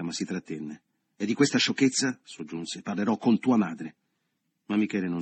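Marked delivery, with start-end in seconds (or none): 1.2-2.2 higher, louder reprimand; stern scolding. A narrator's reading. Leo to Michele over his foolishness, stern scolding. 2.8-4.0 louder, pointed warning; stern warning. A narrator's reading. Leo threatens to tell the boy's mother, stern warning.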